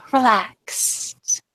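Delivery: put-on English accent after a sigh; sigh, then mock-royal English; British accent. Mimicking the stiff knight, in an English accent. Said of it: "In a British accent, like an English accent".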